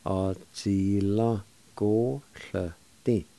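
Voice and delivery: "Á díla go llyti." slowly, syllables stretched apart; slow, careful pronunciation. Shown as a demonstration. Teacher putting "Á díla go llyti." together for learners, slowly. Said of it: "And together".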